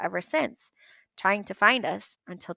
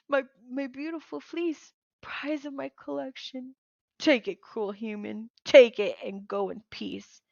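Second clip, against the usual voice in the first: mournful giant; 5 semitones higher than usual. Acted. Sobbing Cyclops gives up fleece.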